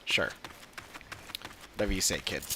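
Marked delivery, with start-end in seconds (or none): none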